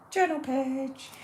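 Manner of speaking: high, slow sing-song label; a lightly hammed-up announcer; playful announcement. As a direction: lift it up high and stretch it out playfully. Announces the sticker title playfully.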